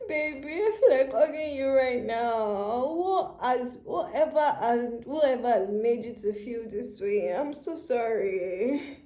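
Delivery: crying while consoling, words drawn out at the end; aching, tender plea through real tears; tearful sympathy. Real, not acted. Reader wants to hug the hurting client, tearful sympathy. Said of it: "now I'm literally crying".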